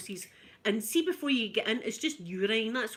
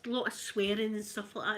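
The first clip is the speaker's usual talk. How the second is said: ordinary delivery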